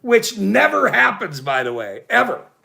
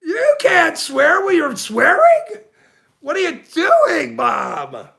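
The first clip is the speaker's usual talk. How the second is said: shocked disbelief; 6 semitones higher, slower than usual. Retold or imitated. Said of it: "I went".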